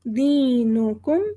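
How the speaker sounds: दीनुकुम with a stretched दी; long dee. Shown as a demonstration. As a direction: lengthen दी in दीनुकुम. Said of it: "दी हमारा हुरूफ मद है". (दी को खींचकर)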